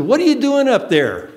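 put-on high, loud, curious child; a kid calling up, puzzled; boy calling up to a man in a tree; little boy voice. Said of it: "He said".

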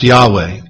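Yahweh as YAH-way; pronounced Yahweh. Shown as a demonstration. Giving listeners one proposed pronunciation of the name, Yahweh as YAH-way. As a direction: say Yahweh in two syllables. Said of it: "Some say it should be pronounced".

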